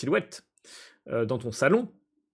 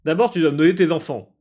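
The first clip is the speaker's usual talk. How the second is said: louder, fast, grand villain command; villain demand; 4 semitones higher, 8 dB louder, faster than usual. Acted. Techno Chamanatrix demanding Gary's children, booming. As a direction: bark the demand like a cult leader.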